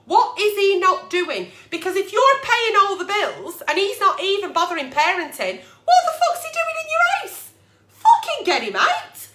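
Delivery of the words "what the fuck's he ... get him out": very high, cracking outraged squeal; outraged shriek. Real, not acted. Agony aunt shrieking in disbelief at the lazy partner. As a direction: let it fly up into a shriek.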